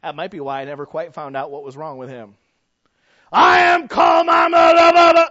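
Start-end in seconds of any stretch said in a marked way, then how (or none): 3.3-5.3 high, furious mock shout; hammed-up yell dissolving into babble; imitated screaming man. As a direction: scream it like an irate customer losing control. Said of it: "came into our office one time yelling and screaming".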